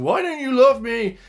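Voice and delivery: high, loud pleading cry; needy monster plea. Retold or imitated. Steele as Frankenstein's monster crying to Nate, high loud plea. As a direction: wail it like a rejected creation begging its maker. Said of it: "Steele is saying".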